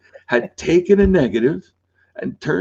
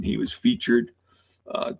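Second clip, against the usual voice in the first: normal talking voice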